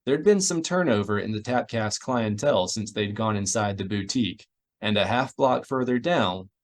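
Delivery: ordinary delivery, nothing marked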